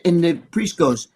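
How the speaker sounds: ordinary talk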